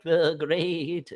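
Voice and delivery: quavering folk-singer voice; shaky voice. Shown as a demonstration. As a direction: tremble on the words like an old record. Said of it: "I tried to do the shaky voice of".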